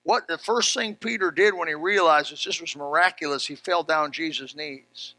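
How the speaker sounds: usual speaking voice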